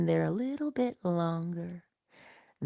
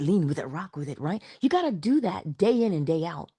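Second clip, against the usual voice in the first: ordinary talk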